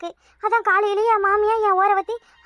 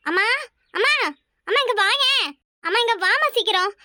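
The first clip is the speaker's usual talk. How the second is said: urgent calls to mother, mildly raised; calling out for mom; 3 semitones higher than usual. Acted. Daughter calling her mother for help, mild urgent call. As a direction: call mom in from the next room, anxious. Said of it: "எதுக்கு இவ்வளவு சத்தமா கத்துற".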